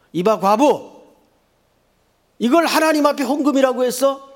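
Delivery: loud, high, outraged shout; thunderous scolding. Acted. Priest shouting at the widow about her offering, furious scolding. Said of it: "벼락같이 소리를 지릅니다".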